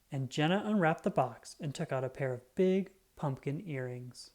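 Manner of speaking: usual speaking voice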